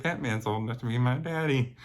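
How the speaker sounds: laughing retort. Retold or imitated.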